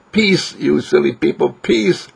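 high-pitched mock shouting. Preacher mimicking someone shouting at the world, high mock shout. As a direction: call it out high, scolding the crowd. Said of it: "shout into the world".

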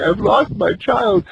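put-on keen over a lost child; mother crying in her hut without looking up, wailing; sobbing mother. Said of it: "the mother continued sobbing".

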